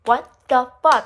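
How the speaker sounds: loud, punchy mock yell; retold yelling. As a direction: yell it at her in exasperation. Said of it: "like, yelling at her".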